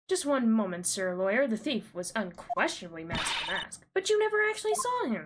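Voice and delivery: lightly hammed-up courtroom dialogue; voicing game characters. Streamer reading the game's courtroom dialogue, lightly acted. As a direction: read the lines like a theatrical lawyer.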